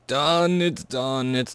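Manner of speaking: high, slowed delighted exclaim; excited. Real, not acted. Gamer seeing his ingots ready, thrilled and excited. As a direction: draw it out, thrilled it finished. Machine finishes titanium.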